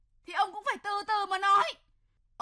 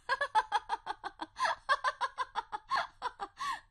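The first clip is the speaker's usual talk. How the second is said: long scornful laugh rising high; mocking laugh; 7 semitones higher, 4 dB quieter than usual. Mother laughing at her husband's hope, mocking. Mother mocks father's claim.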